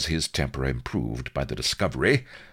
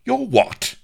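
very high, loud bewildered blare; blared; 6 dB louder than usual. Acted. Admiral blaring at the news of his rival, stunned loud shout. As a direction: blast it out in disbelief. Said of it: "blared Rooke, bewildered".

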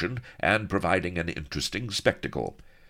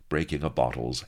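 ordinary delivery

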